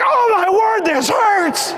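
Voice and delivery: high, loud pained wail; pained outcry. Retold or imitated. Dad alone with his wife, wailing loudly in pain. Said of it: "I'm like".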